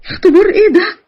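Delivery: high, distraught protest; indignant. Acted. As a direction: challenge his excuse in outrage. Victim rejects his excuse.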